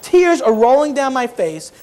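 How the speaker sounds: usual speaking voice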